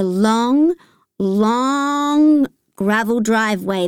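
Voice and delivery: "long, long" stretched out slowly, first one rising; drawn-out LONG. A narrator's reading. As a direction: stretch each "long" to show the distance. Describing a very long driveway.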